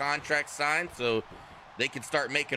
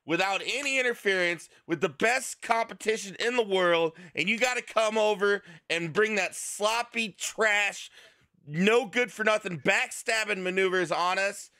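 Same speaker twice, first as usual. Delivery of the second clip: higher-pitched outraged complaint; indignant rant; 6 semitones higher than usual. Acted. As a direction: rant about the dirty interference. Indignant rant at rule-breakers.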